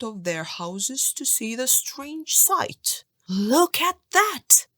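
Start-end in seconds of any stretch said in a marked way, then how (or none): 3.3-4.7 higher, louder, slow exclamation; astonished villagers calling out; excited cry. A narrator's reading. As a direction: cry out in amazement at the sight. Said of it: "they cried".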